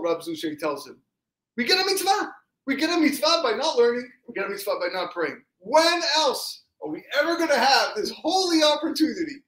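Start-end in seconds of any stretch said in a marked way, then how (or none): 1.6-9.4 high-pitched, elated urging; exultant epiphany. Acted. Brother convincing brother they get a mitzvah, exultant excitement. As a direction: proclaim it like a joyous discovery. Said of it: "he had an incredible, incredible epiphany".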